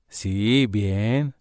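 low, soft, listless answers; dispirited, flat. Retold or imitated. Coworker answering the phone, low and listless. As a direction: sound like you can't be bothered to talk. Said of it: "este tono de".

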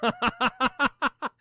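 staccato chuckle; short rapid-fire laugh. Real, not acted. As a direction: let out a quick ha-ha-ha. Laughs at own lead-in.

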